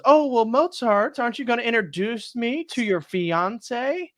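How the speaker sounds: jealous opera singer. Retold or imitated. The opera singer baiting Mozart about his fiance, high mock voice. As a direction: needle him in a high falsetto. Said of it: "opera singer goes".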